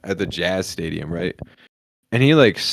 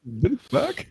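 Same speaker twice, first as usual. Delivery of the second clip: mock baffled; 5 dB quieter than usual. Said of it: "repeats friend's quote".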